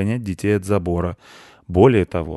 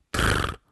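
low rolled insect trill; short low 'trrr' as a bug's reply; 12 semitones lower, 4 dB quieter than usual. Acted. Bug agreeing to the boy's request, low trill.

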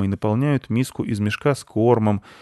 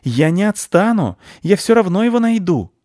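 much higher, louder vow; defiant shout; 12 semitones higher, 7 dB louder than usual. Acted. Squirrel shouts back a vow as she flees. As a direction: shout a stubborn promise.

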